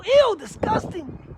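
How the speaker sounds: slow, high, loud mock "ew"; mock disgust. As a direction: pull a hammed-up grossed-out face in the voice. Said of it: "she thinks the kid's gonna go".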